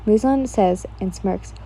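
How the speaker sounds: ordinary delivery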